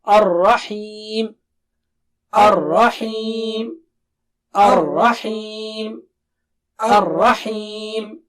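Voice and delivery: Ar-Rahim slow, thick ra and clear ha; teacher drilling the word slowly. Shown as a demonstration. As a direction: recite it slowly and evenly. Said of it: "Let's take the word".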